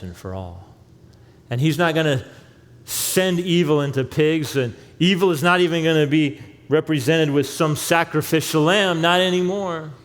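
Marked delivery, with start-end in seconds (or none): 1.5-9.9 louder and higher, driving the point home; emphatic preaching. Real, not acted. Preacher telling the congregation evil is done, loud and fervent. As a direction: preach it with rising force.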